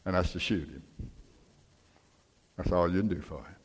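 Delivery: low, soft, resigned delivery; grave, hushed father. Retold or imitated. Dad tells his son the dog must die, gravely. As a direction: drop the voice to break hard news.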